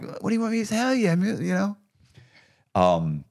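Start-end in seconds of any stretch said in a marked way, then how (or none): 0.0-1.8 higher, a helpless shrug in the voice; exasperated retort. Retold or imitated. Answering his friend Stacy, mildly exasperated and higher. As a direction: throw it back at her, lightly exasperated. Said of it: "and I'm like".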